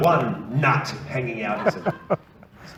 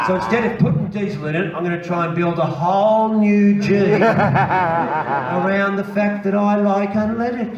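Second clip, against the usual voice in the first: stretched out, slow and louder; slow, laughing mock logic; slow mock reasoning; 7 semitones higher, 7 dB louder, slower than usual. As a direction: drag it out like a stubborn fool. Jeep owner's silly reasoning.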